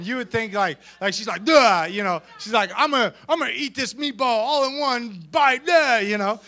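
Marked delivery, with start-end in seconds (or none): 1.4-1.9 very loud oafish burst; mock tough. Imagining sister-in-law as bad to the bone, loud mock-tough grunt. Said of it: "you would think she is bad to the bone". 2.8-6.0 loud put-on brute voice; mock tough. Imagining sister-in-law as bad to the bone, loud mock brute. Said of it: "you would think she is bad to the bone".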